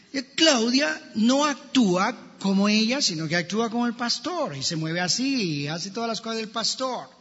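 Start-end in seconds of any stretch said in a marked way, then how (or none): none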